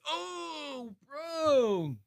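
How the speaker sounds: high, drawn-out whimper of dismay; mock wail. Streamer voicing a dazed boxer on the canvas, mock wail. Acting out Jake hurt in fight.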